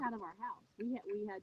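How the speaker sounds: muffled phone audio, far from mic; inside a fishbowl. Real, not acted. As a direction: sounds like talking inside a fishbowl. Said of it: "Why does she sound like she's in a fishbowl?".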